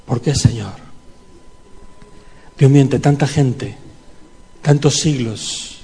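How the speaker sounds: intimate church prayer; heartfelt prayer. Real, not acted. Prayer addressed to God.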